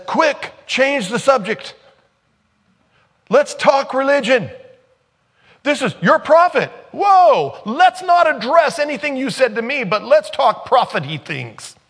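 high, loud mocking voice of the Samaritan woman changing the subject; mock woman dodging. Preacher mimics the woman at the well, high and mocking. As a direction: squeak it out like someone dodging the point.

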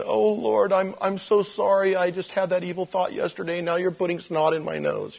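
high, pleading apology to God; exaggerated sorry-prayer voice, easing down; mock penitent. Congregant praying over a stuffy nose, mock penitent. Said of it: "there you are going".

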